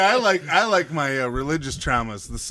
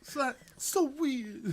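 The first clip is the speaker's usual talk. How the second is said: put-on baby John Travolta; 11 semitones higher, 7 dB quieter, slower than usual. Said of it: "went straight to baby John Travolta".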